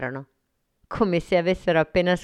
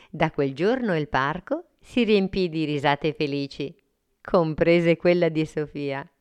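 usual speaking voice

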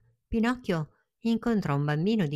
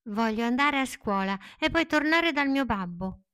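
put-on lightly raised puppet boy voice; a hopeful little boy, voiced a touch higher; Pinocchio's voice; 7 semitones higher than usual. Pinocchio telling the fairy his wish, lightly raised boy voice.

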